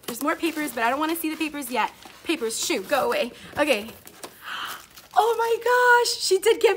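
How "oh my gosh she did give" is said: high, drawn-out delighted gush; thrilled surprise. Real, not acted. Vlogger opening a gift from a secret friend, thrilled surprise. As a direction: gush in amazement, stretching it out.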